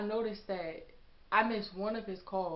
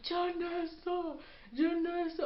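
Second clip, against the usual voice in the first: retold scream; 10 semitones higher, slower than usual. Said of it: "I hear him screaming my name".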